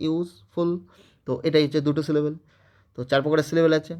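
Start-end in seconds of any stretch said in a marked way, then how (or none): 0.1-0.7 "useful" in two beats, ইউজ then ফুল; pause between syllables. Shown as a demonstration. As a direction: break "useful" briefly before ফুল. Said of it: "একটু থামছি না". ("Useful" দুবারে, মাঝে একটু থেমে)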